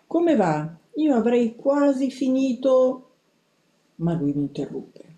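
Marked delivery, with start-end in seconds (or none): 0.1-3.1 high, slow, hesitant squeak; nervous mouse squeak; timid squeak. A narrator's reading. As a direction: squeak out a sheepish excuse to grandfather. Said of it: "io squittii".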